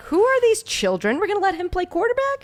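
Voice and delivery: put-on high, incredulous old-timer; baffled disbelief. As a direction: marvel at how young they look. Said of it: "I look at him like".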